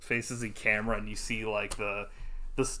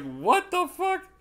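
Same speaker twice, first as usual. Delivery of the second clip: outraged disbelief; 5 dB louder, slower than usual. Retold or imitated. Own retold outraged reaction.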